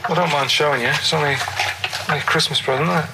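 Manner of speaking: ordinary delivery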